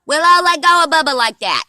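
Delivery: loud shout across the yard; calling out. Real, not acted. Mom calls out to her kids.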